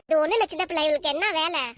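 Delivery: ordinary talk, nothing marked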